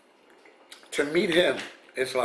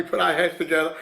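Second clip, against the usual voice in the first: ordinary delivery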